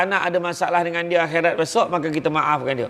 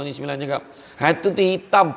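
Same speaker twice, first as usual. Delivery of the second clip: usual speaking voice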